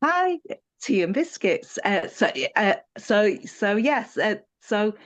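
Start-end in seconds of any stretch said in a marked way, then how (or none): 0.0-1.8 cheery greeting. Retold or imitated. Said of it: "everything became".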